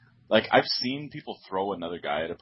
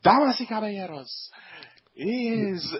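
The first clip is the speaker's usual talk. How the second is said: lucha libre announcer; 12 semitones higher than usual. Retold or imitated. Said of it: "full Spanish intro".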